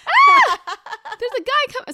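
high, piercing comic scream for help; mock yelling. Friend showing how she would react to an intruder, mock yelling. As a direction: scream for attention, laughing through it. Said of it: "Girl, I'd be yelling".

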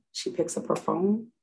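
ordinary talk, nothing marked

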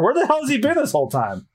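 ordinary delivery, nothing marked

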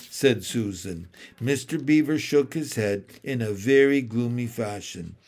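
usual speaking voice

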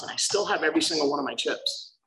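usual speaking voice

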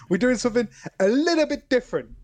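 imitating Call Me Kevin. Quoting a YouTuber's intro, a light mock impression. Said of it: "Every single video is like".

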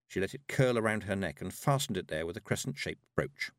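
ordinary delivery, nothing marked

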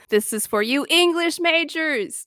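high, slow pompous decree; lofty director proclaiming to the elite; mock director. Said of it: "the director was like".

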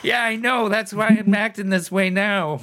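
high-pitched, hammed-up poor-me voice; mock pitiful. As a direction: play up being sick for sympathy. Playing up man flu for sympathy.

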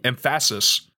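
"emphasis" announced as em-FASS-is; misplaced stress. Shown as a demonstration. Host announces a podcast rename, "emphasis" as em-FASS-is. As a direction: land on the middle syllable of "emphasis", em-FASS-is. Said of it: "it's just E M Fass".

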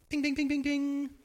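loud, high ping sound effect; correct-answer ding. Pretend. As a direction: ring it out like a quiz bell. Right-answer bell imitation.

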